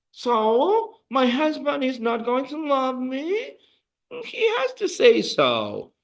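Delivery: high, wondering student voice; mock worried student. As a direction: act out a puzzled learner asking.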